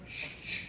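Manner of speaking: soft hushed "shh, shh"; two quiet voiceless hisses for a gun; imitated gun noise. Imitates the gun at his head.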